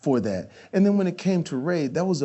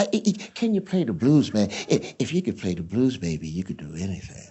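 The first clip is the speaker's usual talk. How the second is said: [name] impression; 6 semitones lower than usual. Actor imitating [name] on playing the blues, voice dropping low. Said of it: "He goes".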